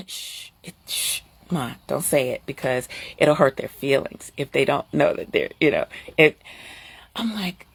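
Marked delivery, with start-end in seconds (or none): 0.1-6.4 hushed son's voice. Retold or imitated. Quoting son hushing her.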